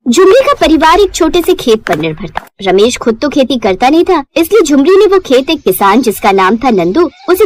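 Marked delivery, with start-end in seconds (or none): none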